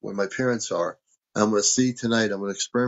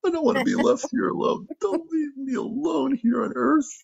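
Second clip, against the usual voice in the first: mock sad plea. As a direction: beg the departing spaceship, hammed up. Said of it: "really sad".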